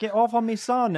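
high, loud, forceful shout; father's furious yell. Acted. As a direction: shout to protect your child. Father fights off attacker.

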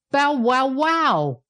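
slow, high, drawn-out bow-wow; gleeful dog bark. A narrator's reading. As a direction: read the bark as gleeful words. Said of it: "The dog barked gleefully".

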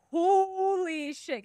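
slow, loud, high exclamation; shocked. Retold or imitated. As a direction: blurt it out stunned. Imagined shocked skeptic.

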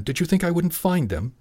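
high-pitched indignant question; demanding. A narrator's reading. Father rounding on son, angrily demanding. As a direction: demand an answer. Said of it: "he demanded".

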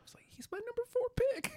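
whispered dismay, no voice. Retold or imitated. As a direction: breathe the words out in a whisper. Retold dismay over lost pick.

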